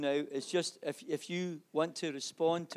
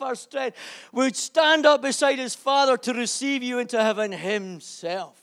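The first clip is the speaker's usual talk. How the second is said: loud, high, tearful preaching; preaching at full force; 10 semitones higher, 12 dB louder than usual. Acted. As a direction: lift the church with a crying shout. Preacher climaxes about heaven.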